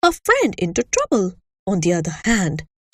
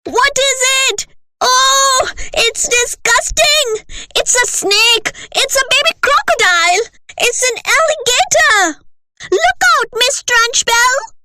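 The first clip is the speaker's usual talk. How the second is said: put-on much higher, louder panicked kids' shrieks; children shouting; 4 dB louder than usual. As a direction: scream in alarm at the creature.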